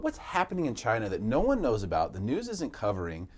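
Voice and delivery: ordinary talk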